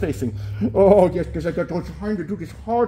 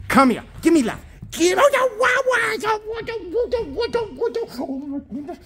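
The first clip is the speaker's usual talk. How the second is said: very high, gushing wows. Real, not acted. Owner cuddling her puppy, squealing high baby talk.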